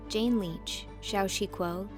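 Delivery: ordinary delivery, nothing marked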